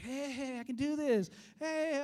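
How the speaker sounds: high, loud delighted discovery; thrilled child. Retold or imitated. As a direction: whoop like a kid who just got it. Imitates kids thrilled to be swimming.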